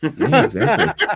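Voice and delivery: laughing. Real, not acted. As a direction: laugh it out high and slow. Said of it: "what are you doing here?".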